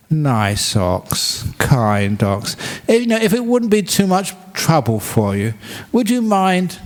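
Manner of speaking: high, gushing mock-polite pleading; sweet coaxing. Farmer sweetly begs his ox to pull the carts. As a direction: sweet-talk the ox like a nervous suitor. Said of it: "because of those kind words".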